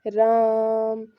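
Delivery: slow, stretched राम; drawn-out name. Shown as a demonstration. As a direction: hold the vowel of राम long and slow. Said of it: "बहुत ज़्यादा इसमें time लगता है".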